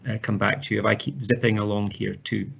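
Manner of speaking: ordinary talk, nothing marked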